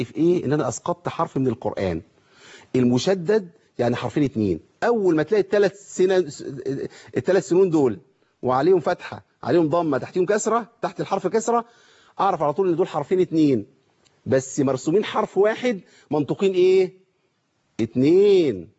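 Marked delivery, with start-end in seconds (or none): none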